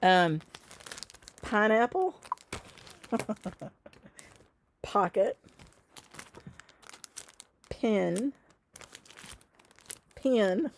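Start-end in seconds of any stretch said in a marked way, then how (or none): 1.4-10.7 words said slowly with her regional vowels, pineapple, pocket, pin; her own accent. Shown as a demonstration. Reminding stitchers of the words, demo pronunciation. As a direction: model each word in her local accent for viewers to copy. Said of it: "You wanna say it like I do".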